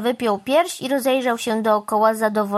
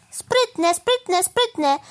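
self-congratulation; 9 semitones higher, much slower than usual. Acted. Crow pleased with himself.

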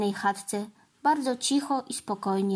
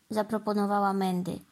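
ordinary delivery, nothing marked

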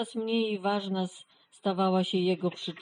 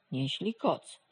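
plain reading voice

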